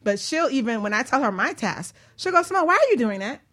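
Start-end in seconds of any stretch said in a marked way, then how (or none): none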